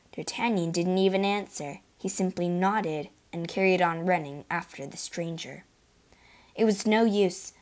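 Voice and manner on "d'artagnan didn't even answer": usual speaking voice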